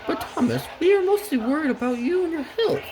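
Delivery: very high falsetto coach voice; Annie and Clarabelle. Acted. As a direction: squeak it out as the little coaches. Worried coaches to Thomas.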